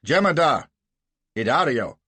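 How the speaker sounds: loud summoning call for the orderly, high and sharp. Acted. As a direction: bark for the servant across the house. Gardner calls for his under-officer.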